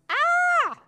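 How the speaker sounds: shrill high yelp of alarm; a troll startled into bolting; sudden very high scared troll shriek; frightened troll cry. Acted.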